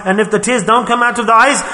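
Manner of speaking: normal talking voice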